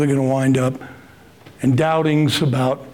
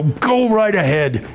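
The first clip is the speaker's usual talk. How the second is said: sarcastic brush-off; 3 semitones higher, slower than usual. Retold or imitated. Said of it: "and I'd go".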